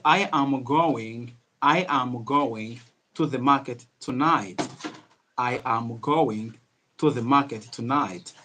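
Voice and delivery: each word spaced out slowly and fully; unhurried, drawn-out teaching pace; slow, careful English. Shown as a demonstration. Teacher models a sentence for learners, slow and careful. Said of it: "speak English in a slow manner".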